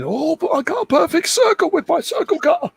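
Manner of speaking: high-pitched silly gloating voice; mock gleeful boast. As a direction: crow about it in a silly high squeal. Mock boast about his cutter.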